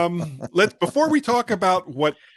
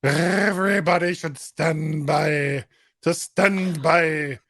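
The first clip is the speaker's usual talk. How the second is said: slow drawn-out; slower than usual. Pretend. Mock announcer sign-off.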